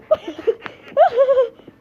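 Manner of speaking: high, loud screaming laugh; shrieking laugh. Real, not acted. Gamer cracking up at the grandpa character, shrieking with laughter. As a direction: burst into a shrill shrieking laugh.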